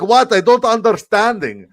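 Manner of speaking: put-on very high, slow, loud Middle Eastern accent; exaggerated Middle Eastern accent, drawn out and yelled. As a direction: shout bafflement in a hammed-up Middle Eastern accent. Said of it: "my Middle Eastern accent".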